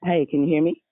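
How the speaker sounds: speaking too close to the phone; too close to mic. Real, not acted. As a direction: mouth right on the phone. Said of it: "you sound really close to the phone".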